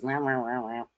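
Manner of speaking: soft mock baby wail. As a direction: wah wah like a whiny child, softly. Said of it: "When my Virgo goes".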